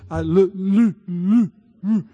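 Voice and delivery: loud stuck stammer on love; can't get the word out; hammed-up tongue-tied stammer; stammering man.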